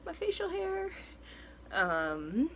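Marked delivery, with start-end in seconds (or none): 0.0-0.9 excited squeal. Retold or imitated. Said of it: "I'm like".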